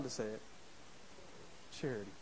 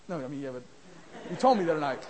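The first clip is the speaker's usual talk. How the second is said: normal talking voice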